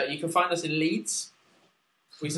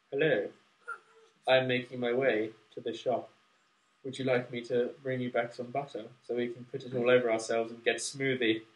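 through cotton wool; 3 semitones lower, 5 dB quieter than usual. Shown as a demonstration. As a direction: read it into a wad of cotton wool. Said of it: "And now, with the, uh, cotton wool".

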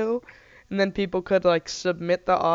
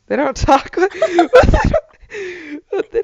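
laughing; 11 semitones higher, 9 dB louder than usual. Real, not acted.

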